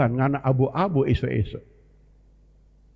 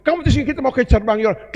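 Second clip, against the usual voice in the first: Elijah's hurried order; 8 semitones higher, 3 dB louder, faster than usual. Acted. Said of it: "Elia menyuruh tinggal".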